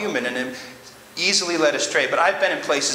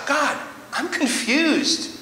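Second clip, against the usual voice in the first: high, pleading voice of a bewildered believer; a believer crying out to God, high and confused; confused prayer; 5 semitones higher than usual. Acted. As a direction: plead to God, lost and unsure, with a nervous laugh.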